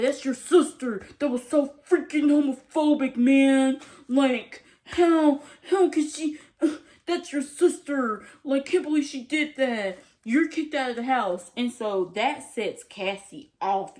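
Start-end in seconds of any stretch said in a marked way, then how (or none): 0.0-11.5 put-on outraged boyfriend. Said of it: "and he's like".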